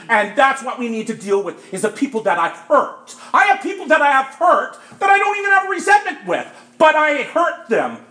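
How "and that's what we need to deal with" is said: ordinary talk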